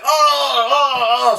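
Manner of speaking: high, drawn-out mock moans; imitated moaning. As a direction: moan like an exasperated crowd, exaggerated. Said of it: "I hear people moaning".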